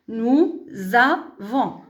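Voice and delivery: "nous avons" very slow, z on "avons"; liaison with z. Shown as a demonstration. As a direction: stretch "nous avons" syllable by syllable with z. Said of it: "ça se prononce".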